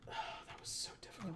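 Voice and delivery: whispered, quick exhausted relief; relieved. Real, not acted. Player after an impossible level, relieved whisper. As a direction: let it out under the breath.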